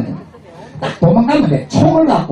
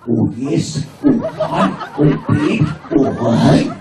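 American moans; 5 semitones lower, slower than usual. Retold or imitated. Mocking American women in bed, mock moans. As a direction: ham up bedroom moans. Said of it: "미국 년들".

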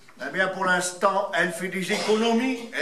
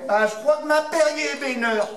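put-on child; 6 semitones higher than usual. Child answers in a joke.

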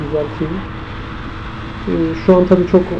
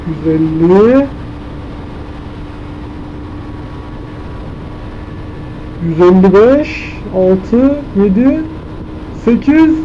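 excited; 3 semitones higher, much slower than usual. Real, not acted. Counting top speed on motorbike.

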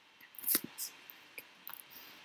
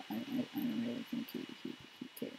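mock low mumbling character; 12 semitones lower, 6 dB quieter, faster than usual. Voicing game character, low whisper.

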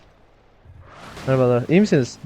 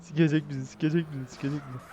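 softer, repetitive aside; worried muttering; 11 dB quieter than usual. Pretend. Player fearing trouble, worried muttering. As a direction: mutter it under your breath, fading.

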